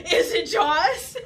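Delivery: high and loud, laughing; laughing mock fear. Girls asking the boys about Jaws, high laughing alarm.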